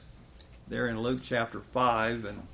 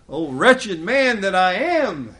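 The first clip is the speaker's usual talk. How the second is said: louder, higher lament; anguished Paul crying out; Paul's cry; 7 semitones higher, 9 dB louder than usual. Acted. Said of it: "even Paul cried out".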